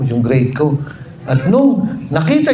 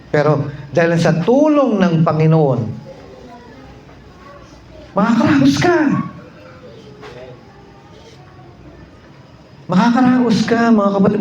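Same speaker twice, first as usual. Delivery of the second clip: a lightly raised preacher's exhortation, repeating the promise; preaching; 3 semitones higher than usual. Acted. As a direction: encourage the brothers and sisters, rising.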